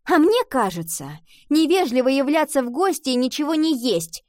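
mildly heated retort; unable to hold back. A narrator's reading. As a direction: blurt out a protest. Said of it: "не выдержала Ива".

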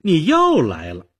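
put-on high, loud exasperated girl; fed up. A narrator's reading. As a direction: groan at him, sick of this. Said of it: "少女厌烦地说". (厌烦地大声说)

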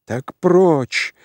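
high-pitched angry outburst; furious cry. A narrator's reading. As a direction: drive him away in a rage. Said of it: "крикнул царевич яростно".

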